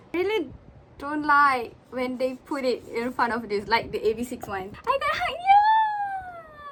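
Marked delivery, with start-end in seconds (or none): 4.9-6.6 high, loud, drawn-out squeal; excited squeal. Real, not acted. Fan pulling a wanted card, excited squeal. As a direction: stretch it out in real excitement. Excited album pull reaction.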